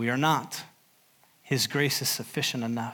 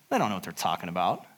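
dismissive pride; 7 semitones lower, 4 dB quieter, faster than usual. Acted. Sermon voicing Pride's dismissive words.

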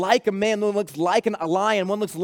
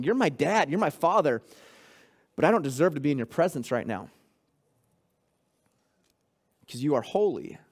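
humble prayer; 4 semitones lower, faster than usual. Shown as a demonstration. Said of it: "starts with acknowledging".

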